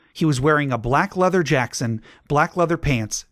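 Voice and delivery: usual speaking voice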